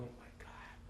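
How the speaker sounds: breathless whispered my God; hushed shock. Retold or imitated. Teacher stunned by the pregnancy news, whispering. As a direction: gasp it under your breath. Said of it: "I was going".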